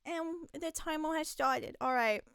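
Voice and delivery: ordinary delivery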